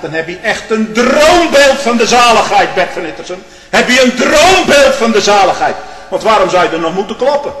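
preacher mocking a colleague's dream of salvation; indignant preaching. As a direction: scold him from the pulpit.

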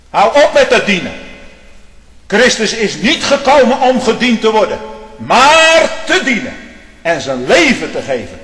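high, loud, thundering pulpit cry; fiery preacher shouting down judgment; preaching at full force. Acted. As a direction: rail at them from the pulpit. Preaching climax on Christ.

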